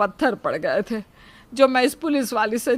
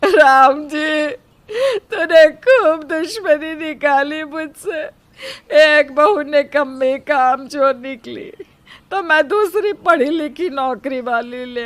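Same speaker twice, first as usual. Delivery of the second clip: crying lament; 3 semitones higher than usual. Acted. Mother-in-law crying to God about her daughters-in-law.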